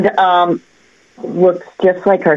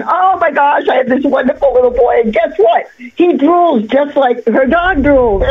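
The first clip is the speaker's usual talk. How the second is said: imitating the client's gushing, raised higher; excited gush; 7 semitones higher than usual. New mother telling the psychic about her boy, excited gushing. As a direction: play the delighted new mother, voice lifted.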